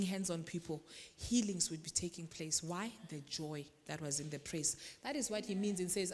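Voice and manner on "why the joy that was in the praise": normal talking voice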